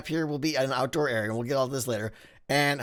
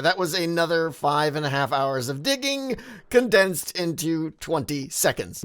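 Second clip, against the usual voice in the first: ordinary talk, nothing marked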